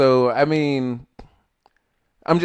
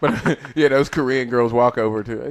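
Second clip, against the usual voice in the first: ordinary talk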